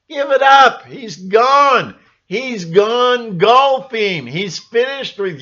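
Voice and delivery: high, loud, slowing jeer; loud mocking dismissal; exasperated outburst. Real, not acted. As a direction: tell them off, fed up. Exasperated at Trump fans.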